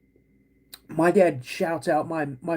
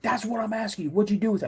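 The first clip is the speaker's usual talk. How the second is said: higher-pitched mock exasperation; exasperated dad; 8 semitones higher, faster than usual. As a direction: imitate a dad demanding an answer. Dad quarrels over missing barrel.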